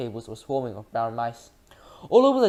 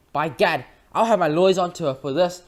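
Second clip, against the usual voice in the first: louder, higher blustering fury; outraged threat; 5 semitones higher, 7 dB louder than usual. A narrator's reading. Mister Jenkins threatening the witch, blustering and loud. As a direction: puff up and threaten.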